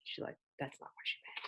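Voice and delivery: hushed whispered aside; soft quick whisper. Real, not acted. Teacher aside to class.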